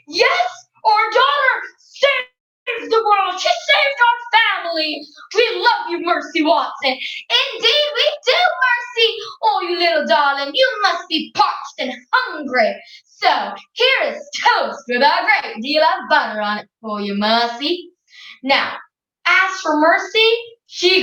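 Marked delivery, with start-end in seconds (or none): 0.1-6.9 beam with pride at the daughter; proud father. Acted. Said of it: "Mister Watson had the first word and said". 6.9-17.9 a lightly voiced fond mother; doting mother. Missus Watson fusses over Mercy.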